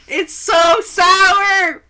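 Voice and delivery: slow, loud, high sour-face cry; shouting in disgust. Real, not acted. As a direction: wail about the sourness. First sip of very sour beer.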